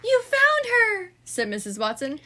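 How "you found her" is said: high, slow and louder with joy; delighted. A narrator's reading. Missus Watson thanking Francine for finding their pig. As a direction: melt with relief.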